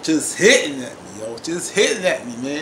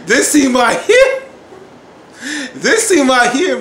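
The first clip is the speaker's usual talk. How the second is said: loud, high and stretched out; emphatic high-pitched raving; overwhelmed hype; 7 semitones higher, 10 dB louder than usual. Real, not acted. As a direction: belt it out slowly, nearly overcome. Overwhelmed by the scene.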